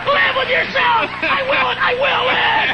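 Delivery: high, slow screaming gloat; triumphant scream. Acted. Comedian screaming victory at his doubters, raging scream. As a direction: scream your victory in their faces.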